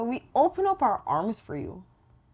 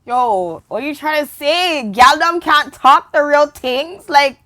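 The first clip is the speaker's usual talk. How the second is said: loud, slow, high and offended; loud offended rant; 6 semitones higher, 13 dB louder than usual. Acted. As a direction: blast it like you're insulted. Mock offended outburst.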